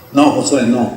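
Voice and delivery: low, softened reply as Moses; understated, low-pitched refusal; calm correction. Acted. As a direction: gently set the young disciple straight, voice dropped. Moses calmly corrects young Joshua.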